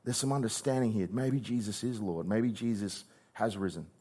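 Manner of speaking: softly voicing a believer's realization; quiet dawning realization. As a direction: let it dawn on you softly. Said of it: "the other one's just like".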